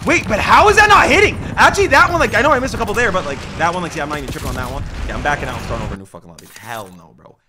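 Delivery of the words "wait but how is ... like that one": high loud exasperated shouting; frustrated yelling. Real, not acted. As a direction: rage at the missed shot. Frustrated at missed shots.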